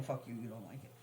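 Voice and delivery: usual speaking voice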